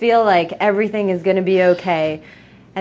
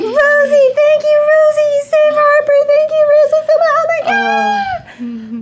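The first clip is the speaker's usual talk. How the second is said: very high, loud hysterical wail; overwrought, high-pitched sobbing shriek; crying and screaming; 6 dB louder than usual. Acted. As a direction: scream your thanks through acted tears. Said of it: "He's, like, crying. He's, like, screaming".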